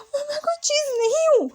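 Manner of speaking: breaking voice, insisting he's not an object; tearful protest. Acted. Wang ji cries out at Wing's remark.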